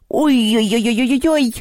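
drawn-out goblin yelp; high-pitched hammed-up howl; high wailing "oy-oy-oy". A narrator's reading. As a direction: wail a long, high string of oys. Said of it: "завопил домовой".

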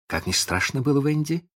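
plain reading voice

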